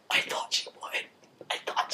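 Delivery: hushed, almost soundless whisper; whispered aside. Retold or imitated. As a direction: whisper it under your breath. Retold private aside, barely voiced.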